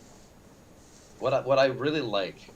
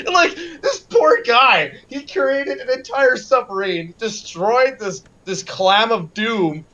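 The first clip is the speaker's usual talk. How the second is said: laughing; 8 semitones higher, 4 dB louder, slower than usual. Real, not acted. Laughing sympathy for Slippy.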